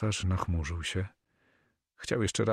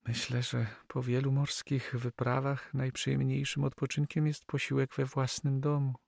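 higher female character voice; Flemish girl, gracious; 7 semitones higher than usual. Acted. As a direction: answer him in a high lady's voice. (uprzejmy, wysoki głos młodej damy)

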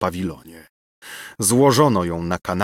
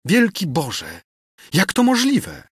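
much higher shocked cry; aghast disbelief; 10 semitones higher than usual. Acted. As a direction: gasp it out in disbelief. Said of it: "zawołała księżna".

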